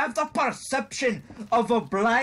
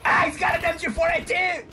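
high, loud cry for help; urgent high-pitched battle shout; alarmed shout; 9 semitones higher, 4 dB louder than usual. Real, not acted. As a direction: yell a warning and call for rescue. Calling for help under fire.

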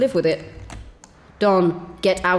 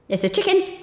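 ordinary delivery

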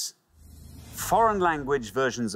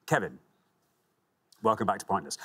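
ordinary talk, nothing marked

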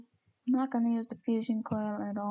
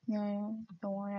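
usual speaking voice

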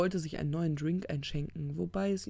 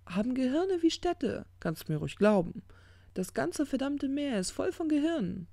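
high-pitched, insistent character voice; carried away by the big idea; rambling enthusiast; 9 semitones higher, 3 dB louder than usual. Acted. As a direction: ramble on excitedly, pitched well up. Crowley rambles about brains.

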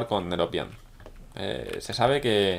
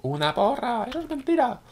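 much higher, incredulous cry of viewers; mock disbelief; 13 semitones higher than usual. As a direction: play the shocked viewers protesting. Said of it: "diréis".

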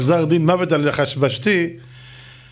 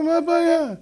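much higher, light advisor voice; calm clever advisor; 12 semitones higher than usual. Acted. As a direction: shrug it off like it's easy. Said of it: "אמר לו".

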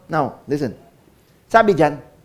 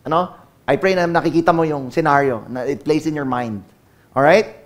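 ordinary talk

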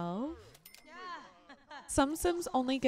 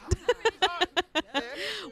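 rapid giggling run; amused laugh; 2 semitones higher than usual. Real, not acted. Player amused by her woohoo mistake, giggling laugh. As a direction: giggle at the misunderstanding.

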